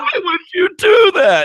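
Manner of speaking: high, loud crying shout; freaking out. Retold or imitated. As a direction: shriek it like a panicked hillbilly. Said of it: "He's freaking out".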